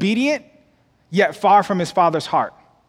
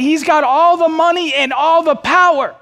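rising preacher shout; 8 semitones higher, 4 dB louder than usual. Real, not acted. Preacher building to climax.

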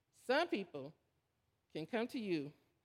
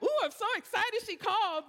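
bubbly high excitement; a burst of giddy delight; excited; 12 semitones higher, 6 dB louder than usual. Retold or imitated. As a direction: squeal it out, thrilled she called. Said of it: "and I'm like".

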